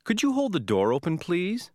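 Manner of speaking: high, lifting polite request. Shown as a demonstration. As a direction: ask politely, voice rising on please.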